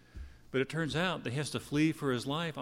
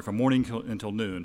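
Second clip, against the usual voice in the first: normal talking voice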